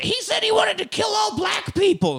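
high mock shout of outrage; hammed-up outrage over music; mocking outraged people. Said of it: "a lot of people actually like".

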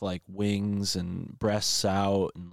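usual speaking voice

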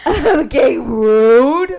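loud playful indignation; mock offended. Real, not acted. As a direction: act offended, loudly.

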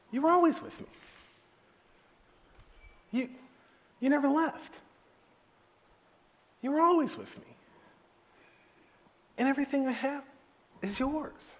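high, gentle reassurance; soft-hearted high-pitched appeal; tender father. Acted. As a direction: reassure a hurt son, leaving long pauses. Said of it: "the father said".

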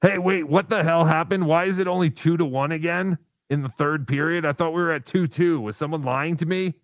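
startled and incredulous; alarmed disbelief. Real, not acted. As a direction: demand answers in sudden disbelief. Startled by the changed game score.

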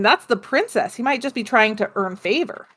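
usual speaking voice

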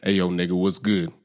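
casual mock slang hello. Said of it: "give me an impression".

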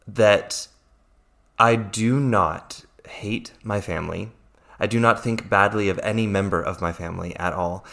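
usual speaking voice

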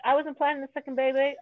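ordinary talk

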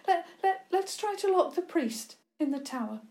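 high, stammering urgency; shaken. A narrator's reading. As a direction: stumble on the first word, pitched high. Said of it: "She was shaking".

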